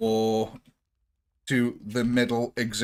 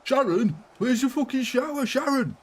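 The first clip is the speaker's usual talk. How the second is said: high put-on voice calling out; hammed-up high shouting voice; mock yelling for Sharon; 12 semitones higher than usual. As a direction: bellow for Sharon in a comic character voice.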